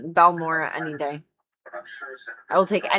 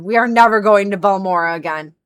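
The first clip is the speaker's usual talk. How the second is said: loud vow; 8 dB louder than usual. Real, not acted. Vowing never to return.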